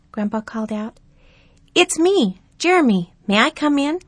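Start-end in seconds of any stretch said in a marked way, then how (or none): 1.7-4.0 put-on high, bright child voice; eager young boy. A narrator's reading. Jeremy voiced in story.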